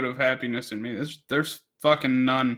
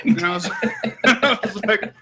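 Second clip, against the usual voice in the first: laughing; 5 semitones higher than usual. Real, not acted. Laughing while retelling truck.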